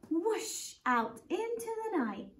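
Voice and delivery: "Whoosh" swept up high like a rocket launch; teacher showing the sound of lift-off. Shown as a demonstration. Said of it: "it says that he went whoosh".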